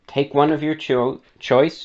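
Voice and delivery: ordinary talk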